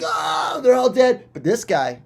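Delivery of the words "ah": dying cry. Acted. Victims dying.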